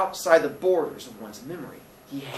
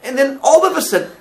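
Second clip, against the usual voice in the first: high, loud, urgent Billy voice; excited, remembering Billy; 11 semitones higher, 10 dB louder than usual. Acted. Billy suddenly recalls the Eton schoolboy story, excited. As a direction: blurt it out as memory returns.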